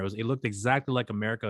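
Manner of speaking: ordinary talk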